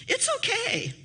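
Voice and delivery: high, lightly voiced mother, then a high laugh; a bright, high little reply ending in a giggle; imitating the mother. Mother's cheerful reply retold.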